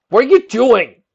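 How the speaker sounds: loud, high furious shout; angry shout. Acted. Shining Armor shouting at Nick over the colt, angry shout. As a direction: bellow at her in anger. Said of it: "Shining Armor shouted in anger".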